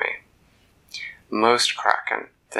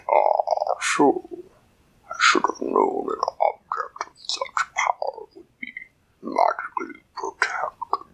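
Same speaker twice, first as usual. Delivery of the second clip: deep kraken voice; 6 semitones lower than usual. Acted. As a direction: speak like a vast creature from the deep. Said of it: "in that deep underwater voice".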